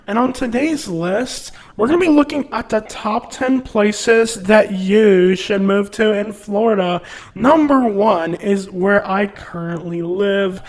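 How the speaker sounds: high, drawn-out mock presenter voice; YouTube realtor. Realtor presenting top ten places on YouTube, mock presenter voice. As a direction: parody a YouTuber reading out a top ten list.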